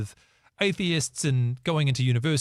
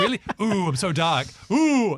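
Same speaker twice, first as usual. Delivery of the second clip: slow, hammed-up "ooh" rising into a high loud squeal; mock spooky taunt; 6 semitones higher, 3 dB louder than usual. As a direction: tease the would-be dark prince. Mocking dark persona again.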